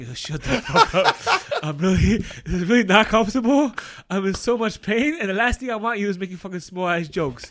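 loud and high through laughter, snapping at him; mock furious shout, laughing through it; wife in labor. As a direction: retell her fed-up outburst with laughing bite. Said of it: "She's like".

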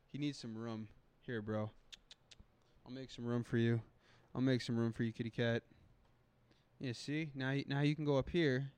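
soft, murmured coaxing to a cat; quiet coaxing. Real, not acted. Making room, coaxing cat.

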